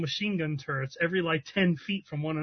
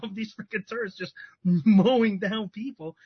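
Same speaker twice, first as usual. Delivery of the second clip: ordinary talk, nothing marked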